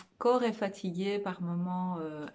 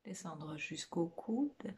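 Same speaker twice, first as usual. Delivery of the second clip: guided meditation; 4 dB quieter than usual. Real, not acted. Meditation teacher guiding listeners through a body scan, soft guided meditation. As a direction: lead them gently inward, phrase by phrase, leaving lots of space.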